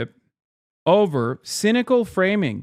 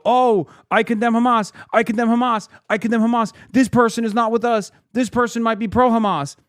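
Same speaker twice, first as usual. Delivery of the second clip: high-pitched, repetitive mocking imitation; mock anxious Democrat; 8 semitones higher, 3 dB louder than usual. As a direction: parrot the party line in a raised voice. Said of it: "Democrats that consistently go".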